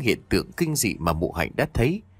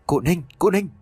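calling out while running; 7 semitones higher, 3 dB louder than usual. A narrator's reading. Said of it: "vừa chạy vừa gọi".

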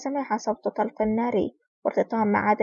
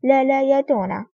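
narrated cry of fear, louder; terrified plea; 3 semitones higher, 5 dB louder than usual. A narrator's reading. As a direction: plead in moderate alarm, voice raised. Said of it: "صاح برعب". (صيحة رعب متوسلة في السرد)

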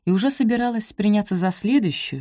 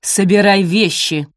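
slow, loud, venomous order; furious hiss; 3 semitones higher, 8 dB louder, slower than usual. A narrator's reading. Mistress orders maid to pack, furious. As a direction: order her out with cold fury. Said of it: "яростно прошипела Араминта".

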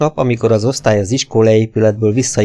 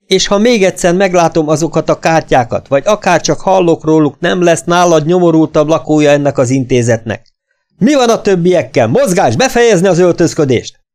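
angry threat; 7 semitones higher, 3 dB louder than usual. A narrator's reading. Instructor threatens and orders boys.

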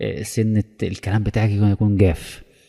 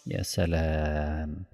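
drawn-out, soft mocking يا سلام; devil's mock admiration, slow and soft; sarcastic; 5 semitones lower, 8 dB quieter, much slower than usual. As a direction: stretch يا سلام slowly and quietly, full of irony.

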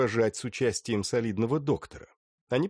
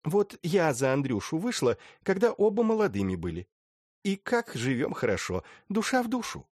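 contented lady voice; 8 semitones higher than usual. Acted. Rostotskaya on her marriage.

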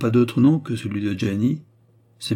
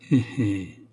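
sly chuckle. A narrator's reading.

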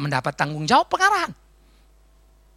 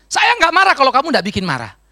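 mock angry retort; 6 semitones higher, 7 dB louder than usual. His own retort to them.

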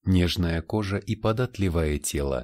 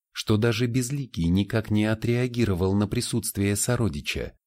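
ordinary delivery

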